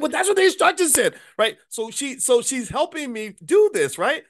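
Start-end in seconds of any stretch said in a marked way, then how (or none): 0.0-1.1 much higher, louder indignant whine; high defensive whine. Retold or imitated. Defending himself to his daughter, a loud high whine. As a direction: protest like a kid caught out. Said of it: "I'm like".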